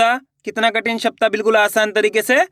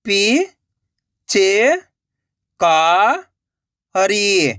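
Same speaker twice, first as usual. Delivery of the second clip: syllable by syllable; much slower than usual. Shown as a demonstration. Teacher sounding out a word for learners, syllable by syllable. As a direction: separate drawn-out syllables with long gaps. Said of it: "एक एक अक्षर का उच्चारण करके".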